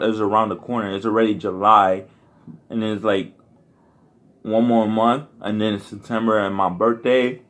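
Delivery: ordinary talk, nothing marked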